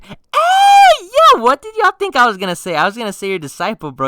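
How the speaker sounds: high, loud comic protest; mock-offended yelp. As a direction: cut yourself off with a shrieked denial. Mock protest at listeners' assumption.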